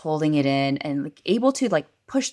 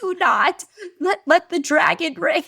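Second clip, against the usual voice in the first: put-on high, loud little-boy voice; imitated child's dramatic command; King; 7 dB louder than usual. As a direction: warn solemnly like a small guard. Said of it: "He's like".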